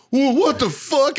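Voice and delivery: high, slow mock outrage; drawn-out, high-pitched bewilderment; imitated shocked disbelief. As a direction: squeal it like a stunned prank victim. Retold victim learns it's a prank.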